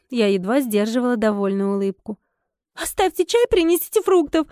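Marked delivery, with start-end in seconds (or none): 2.8-4.5 high-pitched, mildly tearful whine; sobbing. A narrator's reading. Upset guest orders tea and fruit, sobbing. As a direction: order it through light mock sobs. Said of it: "всхлипнула девушка".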